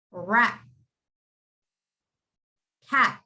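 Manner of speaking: Rat. Cat. with the tongue stopping on the T, no release; stopped final T. Shown as a demonstration. Teacher demonstrates stopped T on Rat. and Cat. for students. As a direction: model Rat. and Cat. cleanly, T held at the roof. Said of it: "My tongue touches when I say cat".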